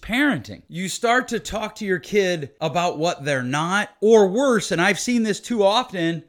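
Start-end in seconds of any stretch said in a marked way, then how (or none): none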